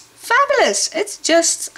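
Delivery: high delighted gush. Real, not acted. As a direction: swoon over it. Gushing about milestone.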